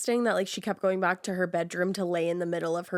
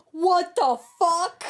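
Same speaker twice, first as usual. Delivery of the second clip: slow, high and loud, stunned by the news; shocked disbelief; 8 semitones higher, 5 dB louder, much slower than usual. Real, not acted. Listener hearing the dead woman speak, slow stunned high disbelief. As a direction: drag it out in total disbelief. Reacting to ghost story twist.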